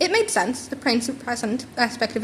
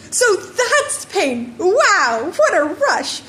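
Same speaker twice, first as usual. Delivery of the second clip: much higher, very slow, laughing wonder; giddy laughing boy discovering pain; thrilled; 8 semitones higher, 4 dB louder, much slower than usual. A narrator's reading. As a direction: marvel at the rush, giddy. Boy thrilled after feeling pain.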